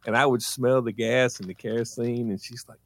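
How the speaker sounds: normal talking voice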